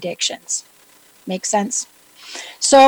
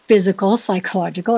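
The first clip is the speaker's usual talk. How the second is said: ordinary talk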